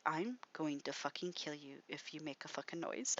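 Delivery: a soft, low-voiced threat; hushed threat. Gunman warning the witch not to wake spiders, hushed. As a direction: threaten quietly so nothing wakes.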